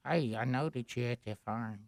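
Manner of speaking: low, hushed creepy horror voice; horror movie villain. Pretend. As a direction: menace them softly like a stalker. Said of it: "like, a horror movie involving Gary".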